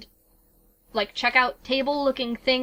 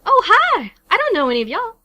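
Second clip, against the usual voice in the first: high, loud southern greeting; southern shopkeeper, lifted and loud; southern accent; 11 semitones higher, 8 dB louder than usual. Acted. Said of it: "doing a southern accent for these characters".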